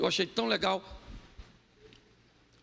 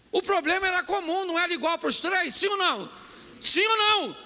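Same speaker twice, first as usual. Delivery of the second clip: high, loud preaching cry; fired-up sermon delivery; 9 semitones higher, 3 dB louder than usual. Acted. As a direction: drive the point home to the church. Preaching at full force.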